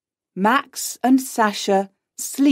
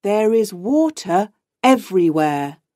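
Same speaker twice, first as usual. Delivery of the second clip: plain reading voice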